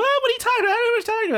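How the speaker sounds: very high, squeaky denial; flustered denial. Retold or imitated. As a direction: squeak it out in embarrassment. Girl denying crush, flustered.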